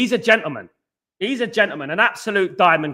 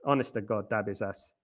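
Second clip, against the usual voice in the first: muttered under the breath, much softer and lower; exasperated mutter; 9 semitones lower, 12 dB quieter than usual. Real, not acted. Streamer fed up with a chatter, exasperated mutter. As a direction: mutter it in weary disbelief.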